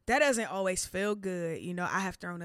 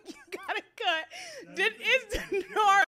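high laughing, barely words; cracking up; 5 dB louder than usual. Real, not acted. Laughing fit.

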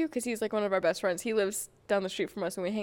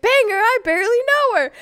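punchline blurt; 12 semitones higher, 13 dB louder than usual. Retold or imitated. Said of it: "And I was like".

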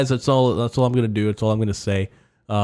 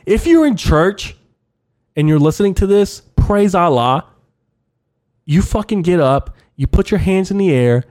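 much higher and louder, whipping up a congregation; over-the-top hype; 8 semitones higher, 5 dB louder than usual. Acted. Comedian urging church listeners, higher and louder. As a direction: preach it like a revival. Hyped church sermon parody.

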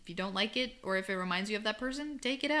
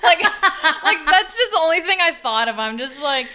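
laughing while talking, higher and louder; 8 semitones higher, 15 dB louder than usual. Real, not acted. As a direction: talk through giggles. Laughing at her own joke.

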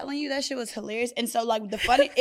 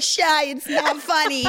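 slow, higher, hurt protesting of a friend on the ground; mock whiny protest; 5 semitones higher, slower than usual. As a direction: imitate a hurt friend insisting it's not funny. Friend imitates her protesting.

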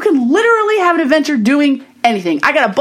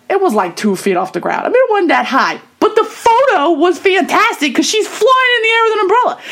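ordinary talk

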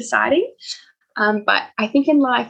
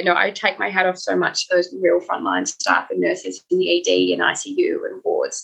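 usual speaking voice

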